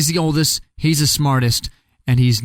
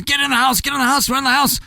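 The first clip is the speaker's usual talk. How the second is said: high, fast, frantic warning; urgent cry to hurry the brother inside; panicked pig; 12 semitones higher, faster than usual. Acted. Fleeing pig urging his brother into the house, frantic and high.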